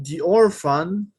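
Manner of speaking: "the orphan" drawn out slowly, "the" as "di". Shown as a demonstration. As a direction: stretch out "the orphan", saying "di". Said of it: "lo estoy pronunciando de la misma manera". ("the orphan" lento, con "the" como "di")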